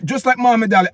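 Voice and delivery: normal talking voice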